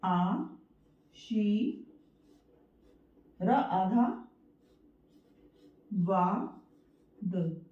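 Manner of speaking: आ, शी, र आधा, वा, द each said alone with long pauses; teacher splitting the word apart; syllable breakdown. Shown as a demonstration. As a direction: break आशीर्वाद into syllables, pausing between each. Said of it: "अगर हम इसका विच्छेद करते हैं".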